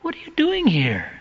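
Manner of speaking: startled cry of the name; a mother who can't believe who is at the door; shocked mother. Acted. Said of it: "just about falls off".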